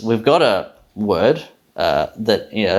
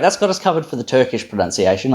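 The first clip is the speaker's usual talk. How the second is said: ordinary talk, nothing marked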